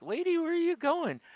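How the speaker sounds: high, mock-baffled challenge; retold incredulity. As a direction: pitch it way up as if baffled by her. Retelling reaction to queue-jumper.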